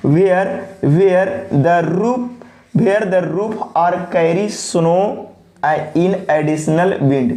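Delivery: very slow, every word drawn out for copying; dictation pace. Shown as a demonstration. Teacher dictating the definition for students to copy, very slowly. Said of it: "copy भी करेंगे pause करके".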